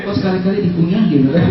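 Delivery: ordinary talk, nothing marked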